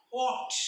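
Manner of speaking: "walked" ending in T, not D; final T sound. Shown as a demonstration. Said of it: "आपण ट असा उच्चार करतो". ("walked" च्या शेवटी ट असा उच्चार)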